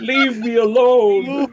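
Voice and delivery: high, drawn-out mock scream. Player voices Lonka after psychic scream, mock screaming. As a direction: wail it long and loud, hammed-up. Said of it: "I start screaming".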